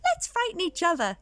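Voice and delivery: little skeleton voice. Acted. Little skeleton to big skeleton, eager high voice. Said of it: "he said".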